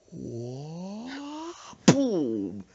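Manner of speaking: long rising whoosh, then a big drawn-out boom; slow-motion hit. Shown as a demonstration. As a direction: stretch it like a Matrix punch. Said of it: "it was like the Matrix, slow motion".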